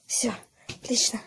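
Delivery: slow relieved whisper; hiding whisper. Real, not acted. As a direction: whisper it so nobody hears. Hidden, relieved.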